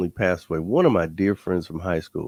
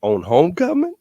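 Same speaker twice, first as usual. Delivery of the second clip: incredulous echo; 7 semitones higher, 6 dB louder than usual. Retold or imitated. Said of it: "I said".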